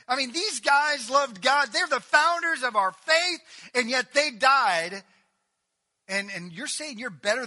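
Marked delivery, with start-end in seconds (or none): 0.1-5.0 loud, high impassioned preaching, slowing at the end; fervent preaching. Acted. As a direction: plead the point to the crowd.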